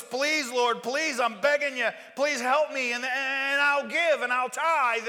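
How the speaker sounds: loud, desperate begging to the Lord; loud pleading voice of a sinner in trouble; begging prayer. Acted.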